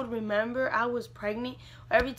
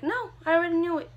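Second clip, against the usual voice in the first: quick, higher, louder brush-off; retold insistence; 7 semitones higher, 5 dB louder, faster than usual. Mom answering her pregnant daughter, briskly insistent. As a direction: insist you knew all along, briskly.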